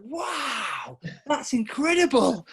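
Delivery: high, slow, wide-eyed wonder; excited kid. Retold or imitated. Telling the coach with a child's excited awe. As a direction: gasp it out like a thrilled child. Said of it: "like a big excited kid".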